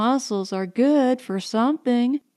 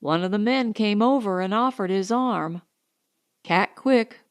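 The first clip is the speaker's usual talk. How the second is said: plain reading voice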